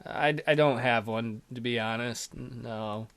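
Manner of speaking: low, soft and slow; subdued hesitant confession; sheepish admission. Acted. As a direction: trail down into an embarrassed murmur. Shy character admits no girlfriend.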